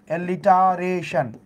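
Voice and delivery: Alliteration stretched out slowly, syllable by syllable; slow, drawn-out term. Shown as a demonstration. Teacher answers her own question for the class, slowly. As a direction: drag out alliteration so students can repeat it. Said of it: "ওই জিনিসটাকে কি বলা হয়?".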